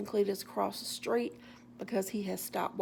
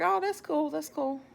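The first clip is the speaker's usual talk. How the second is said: higher-pitched, humouring him politely; placating enthusiasm; 8 semitones higher than usual. Retold or imitated. Walker not wanting to steal his joy, placating enthusiasm.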